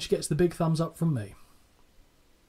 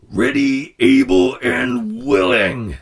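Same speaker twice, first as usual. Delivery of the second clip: higher, louder, dragged-out promo voice; Macho Man impression; 6 semitones higher, 9 dB louder, much slower than usual. Retelling Savage's answer to Gene, loud wrestler impression. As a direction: stretch each word like a wrestling promo. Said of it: "apologies for the impression".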